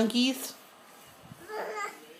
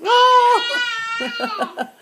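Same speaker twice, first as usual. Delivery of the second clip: delighted gasp; 13 semitones higher than usual. Real, not acted. Baby almost crawls.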